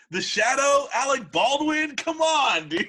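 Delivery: high, loud cry of disbelief; incredulous outburst. Real, not acted. Ribbing a friend over a bad movie, loud incredulous cry. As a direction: throw up your hands at a friend's bad pick. Mocking a bad casting choice.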